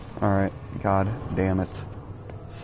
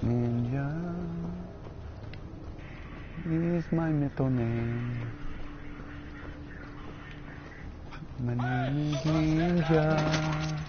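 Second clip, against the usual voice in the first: soft, very slow hushed ninja menace; stealthy ninja voice; 4 semitones higher, 9 dB quieter, slower than usual. Pretend. Gamer playing it stealthy as a ninja, soft slow menace. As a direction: breathe it like a shadow about to strike. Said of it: "Maybe I should play it stealthy".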